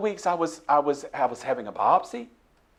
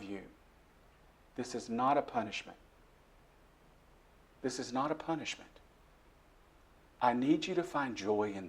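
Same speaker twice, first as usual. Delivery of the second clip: sweet voice of God; 5 dB quieter than usual. Retold or imitated. God comforting him in his darkest hour, soft and tender. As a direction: speak gently as a loving God. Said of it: "the sweetest voice I've ever heard".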